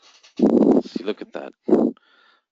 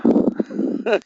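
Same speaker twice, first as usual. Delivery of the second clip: laugh; 4 dB louder than usual. Real, not acted. Laughs at his own story.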